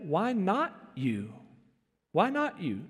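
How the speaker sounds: soft, slow, quietly asked; gentle "Why not you?" to a suffering friend; gentle question. Retold or imitated. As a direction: ask it tenderly to a hurting friend. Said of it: "come back gently and say".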